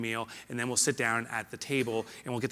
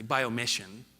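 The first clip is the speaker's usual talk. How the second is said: ordinary talk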